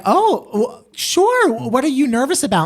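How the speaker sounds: high, startled reassurance; surprised reassurance. Retold or imitated. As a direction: squeak out a surprised sure. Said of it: "And we were like".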